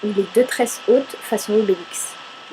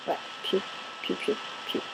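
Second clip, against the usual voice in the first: whispered piou; 2 semitones lower, 12 dB quieter than usual. Shown as a demonstration. Imitating bird chirps softly.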